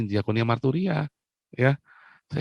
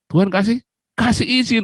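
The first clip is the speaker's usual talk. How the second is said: excited exclamation; 11 semitones higher, 5 dB louder than usual. Real, not acted. God granted his wish.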